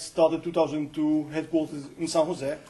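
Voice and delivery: ordinary talk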